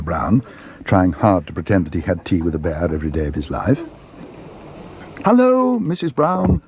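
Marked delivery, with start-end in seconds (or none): 5.2-6.6 high, eager hailing call; high-pitched greeting called to someone far away. A narrator's reading. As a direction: call brightly across the room. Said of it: "called Paddington as he caught sight".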